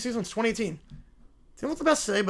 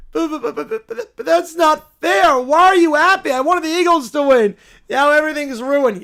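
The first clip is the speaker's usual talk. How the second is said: loud, high-pitched sputtering tantrum; childish outraged whine; whiny sore loser; 6 semitones higher, 10 dB louder than usual. Acted. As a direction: wail in protest at the unfairness. Plays upset whiner.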